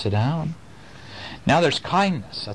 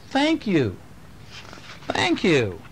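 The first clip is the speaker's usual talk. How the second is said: first Thank raised high, second plain; polite thank you; 5 semitones higher, much slower than usual. Shown as a demonstration. Dad teaching his kids to say thank you, demonstrating. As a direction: model the words "Thank you" for a child. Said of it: "what you say is you say".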